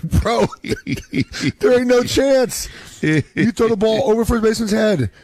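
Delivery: high, laughing through the words; amused teasing dad, laughing at his son's claim; laughing disbelief. Retold or imitated. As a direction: laugh it off as you tell him no chance.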